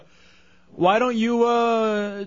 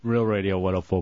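lower, announcer's station ID; radio ID voice; 11 semitones lower, 5 dB quieter than usual. Shown as a demonstration. Host giving the station ID in a deep announcer voice. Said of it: "I do that".

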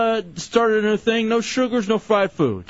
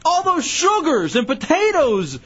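high-pitched, incredulous grilling; indignant interrogation; exasperated scolding; 8 semitones higher than usual. Real, not acted. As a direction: scold her in raised pitch, stretching out the list. Scolding mother over her diet.

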